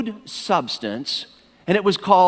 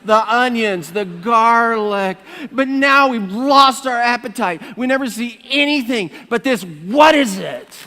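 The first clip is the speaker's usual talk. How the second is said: hammed-up whiny Bible reading; 4 semitones higher, 3 dB louder than usual. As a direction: wail it like a hungry complainer. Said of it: "read this with a whiny voice".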